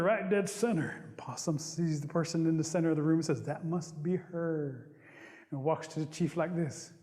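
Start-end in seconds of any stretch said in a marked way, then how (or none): 3.4-4.9 very slow, drawn-out wonder; smitten. Acted. Possum spotting his supposed bride, smitten. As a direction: linger dreamily on each word.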